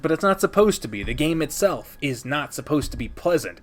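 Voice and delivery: normal talking voice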